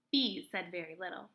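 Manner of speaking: usual speaking voice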